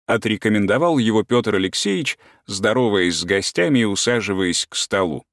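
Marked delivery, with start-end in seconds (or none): none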